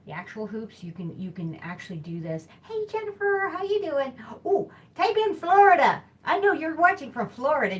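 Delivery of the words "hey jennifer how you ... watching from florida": high, loud, bubbly hello to a viewer; host greeting viewer Jennifer, delighted and loud; excited greeting. Real, not acted. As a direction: call out to a friend watching, much higher and louder.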